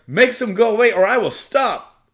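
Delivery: high loud demand in her voice; high pitched loud ultimatum; girl's ultimatum. Retold or imitated. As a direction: insist or else.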